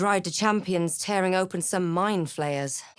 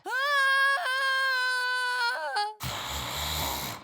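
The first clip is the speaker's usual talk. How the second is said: long high wailing cry, fading out; anguished cry. Acted. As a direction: let out a drawn-out pained wail. Anguished cry during spellcasting.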